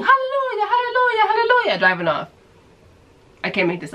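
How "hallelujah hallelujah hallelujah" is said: high joyful shout, laughing; yelling hallelujah. Retold or imitated. Stranger drives off shouting hallelujah, high and ecstatic. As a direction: belt out hallelujah in rapture. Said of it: "she's yelling".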